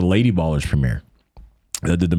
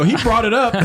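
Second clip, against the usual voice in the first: laughing retort. Retold or imitated. Retold comeback, laughing.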